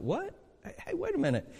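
high, startled double take. Retold or imitated. Reader doing a double take at the verse, startled and high. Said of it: "a double take".

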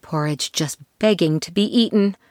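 plain reading voice

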